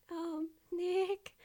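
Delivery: high sidekick voice; hesitant assistant. Acted. Assistant gets the lawyer's attention, high hesitant voice. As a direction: broach it timidly, pitched high.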